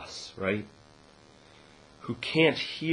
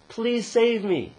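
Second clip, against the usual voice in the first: pleading; 12 semitones higher, 8 dB louder, much slower than usual. Retold or imitated. Man begging God on cliff.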